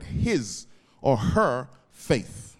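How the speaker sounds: "his or her faith" said very slowly, every word weighted; stress on his or her faith. Shown as a demonstration. Preacher slows right down to stress whose faith it is. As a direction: lean hard on "his or her faith", drawing it out. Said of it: "and I want to emphasize that word".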